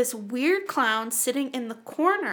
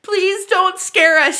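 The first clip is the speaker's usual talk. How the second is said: slow, higher, louder warning; mock threat; 6 semitones higher, 6 dB louder, slower than usual. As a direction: hold out the sword and warn. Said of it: "she's like".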